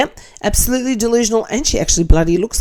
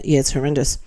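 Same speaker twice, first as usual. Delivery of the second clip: ordinary delivery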